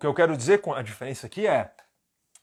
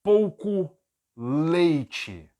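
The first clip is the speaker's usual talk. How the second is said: slow model phrase; 3 semitones higher, 4 dB louder, much slower than usual. Shown as a demonstration. Teacher sets phrase to translate, word by word. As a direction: separate "pouco" and "leite" for the learners. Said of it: "Se eu quiser dizer".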